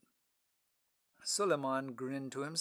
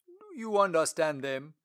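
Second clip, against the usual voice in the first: Lord Treasurer voice; 3 semitones higher, 7 dB louder than usual. Acted. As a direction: speak like a dignified royal treasurer.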